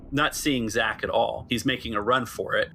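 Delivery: ordinary delivery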